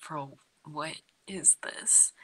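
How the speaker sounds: mock whisper. Retelling watching the creepy cellar.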